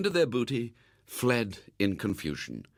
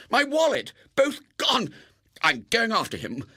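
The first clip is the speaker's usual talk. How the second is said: high, loud outraged cry; a boy raging at the thief; furious; 11 semitones higher, 4 dB louder than usual. Acted. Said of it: "almost inarticulate with fury".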